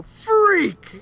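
high, loud mock holler; grandpa's shouted outburst. Imitating the grandpa calling out an applicant, mock shout. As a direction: yell it out as one drawn word, imitating the grandpa. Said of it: "you just hear the grandpa go".

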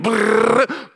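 a lip-buzz sound for posting fast; buzzing lip trill. Retold or imitated. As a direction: trill the lips like hitting send. Typing sound effect.

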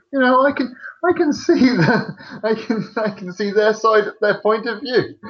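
high, airy put-on voice for the inner thoughts; mock inner voice. A narrator voicing his friend's thoughts in the cinema, high silly voice. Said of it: "just you going".

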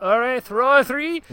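high, loud lisping Roman official; hammed-up lisp with no R's; Roman lisp accent. Pontius answering the crowd, hammed-up lisping accent. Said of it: "accent that kinda sounds like a lisp".

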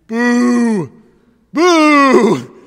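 loud crowd boos; crowd booing. Retold or imitated. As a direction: boo like a disappointed crowd. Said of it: "out of the crowd came a few".

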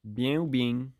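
demonstrated Marseille accent. Shown as a demonstration. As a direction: model Marseille-accented French. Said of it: "but with a Marseille accent".

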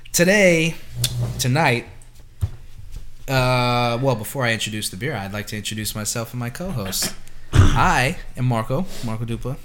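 loud show-host introduction; announcer voice. Acted. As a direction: announce the co-hosts grandly.

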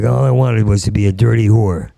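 ordinary delivery

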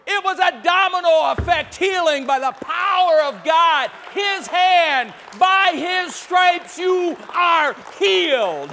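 loud, high shouted proclamation; full-force preaching. Real, not acted. Preacher proclaiming healing to the congregation, shouting. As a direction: thunder it out, stretching the last words.

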